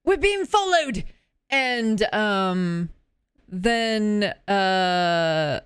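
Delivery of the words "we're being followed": high-pitched urgent call. Acted. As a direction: shout a warning to companions. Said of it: "and then calls out".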